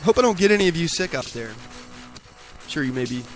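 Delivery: ordinary delivery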